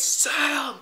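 high, mock-urgent cries; repeated high-pitched calls, half-screamed; retold panicked scream. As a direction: imitate someone screaming a name, not at full volume. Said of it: "with Alex screaming over my face".

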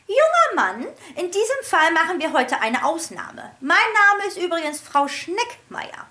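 high, loud prim rebuke; high prim teacher correcting a boy; high firm teacher. A narrator's reading. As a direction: put the boy in his place. Teacher addressing rude boy.

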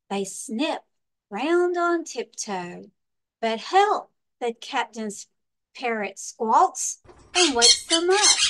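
lively storybook read-aloud with big swings; reading to children. Acted. Librarian reading a pirate picture book to children, lively storytime voice. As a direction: read it like storytime for little kids.

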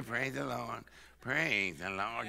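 soft, low, drawn-out whining praise; mock whine. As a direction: imitate a weak, whiny churchgoer. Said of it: "get the whine".

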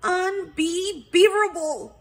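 high, loud huff; huffy indignation. A narrator's reading. Accused beaver protesting to detective, in a huff. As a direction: huff it out, affronted. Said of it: "Becky huffed".

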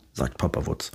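ordinary delivery

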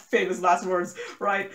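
ordinary talk

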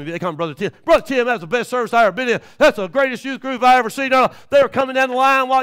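loud, high fired-up preaching; preaching at full force. Acted. Preacher's story at full volume.

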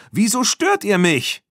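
loud, high indignant question; angry shout. A narrator's reading. Erik to the girls who distracted him, angry shout. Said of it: "rief er wütend".

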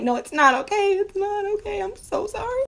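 high whimper, rising and softening; mock tearful apology. Embarrassed woman apologizing to onlookers, mock tearful.

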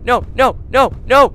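high loud panicked yelling; frantic yells while fleeing; yelling while running away. Real, not acted.

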